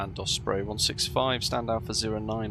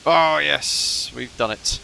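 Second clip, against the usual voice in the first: excited; 4 semitones higher, 8 dB louder, slower than usual. Real, not acted. Success after struggle.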